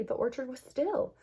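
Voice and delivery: plain reading voice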